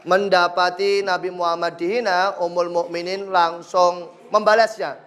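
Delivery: normal talking voice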